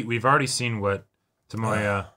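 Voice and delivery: ordinary talk, nothing marked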